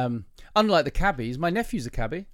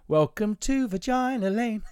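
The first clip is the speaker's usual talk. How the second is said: high, slow, hammed-up children's TV welcome; host mocking a children's TV intro; kids' show host; 8 semitones higher, slower than usual. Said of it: "That sounds like a kid's show".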